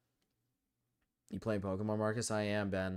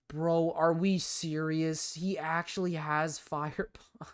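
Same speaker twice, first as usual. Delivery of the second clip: high, slow, stretched-out disbelief; real stunned, drawn-out incredulity; incredulous; 7 semitones higher, slower than usual. Real, not acted. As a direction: drag it out in high-pitched disbelief. Opponent unexpectedly has Fire Punch.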